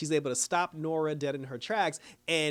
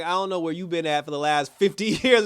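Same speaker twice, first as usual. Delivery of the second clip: laughing; 3 semitones higher, 7 dB louder than usual. Real, not acted. Sassy retort with laugh.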